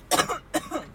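sharp coughing fit. Real, not acted. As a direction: cough out the water.

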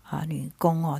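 usual speaking voice

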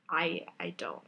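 ordinary talk, nothing marked

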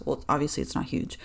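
breathy whisper tossed off as a quick self-correction; whispered correction, half to herself; whispered aside. Real, not acted. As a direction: drop to a whisper for a side remark.

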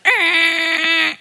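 short sharp cry as the road swerves; sudden cry of alarm. Retold or imitated. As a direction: mimic a startled yelp at the turn. Acting out a sudden U-turn.

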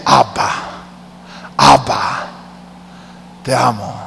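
hushed, tender prayer. Real, not acted. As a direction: whisper your love to the Father.

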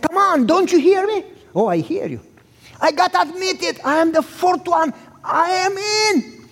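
high, eager pleading to dad; excited insisting. Retold or imitated. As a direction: push your big news on him. Son insisting to unimpressed father.

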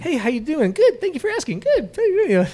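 very high sing-song replies; mock rote exchange. Customer and cashier trading rote lines in high mock voices. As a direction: parody a robotic back-and-forth. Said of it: "mocked rote exchange".

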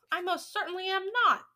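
high, loud, indignant denial; indignant shout. A narrator's reading. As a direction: shout it back, deeply offended. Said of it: "he shouted".

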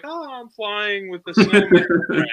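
slow, high and floaty; dreamy voice. Retold or imitated. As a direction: drift through it, wide-eyed and gentle. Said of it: "Another one's like".

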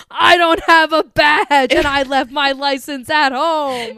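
loud, drawn-out bluster; loud, hammed-up confession; mock blustering shout. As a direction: belt it out slowly as a blustering cop. Acted angry cop quote, loud.

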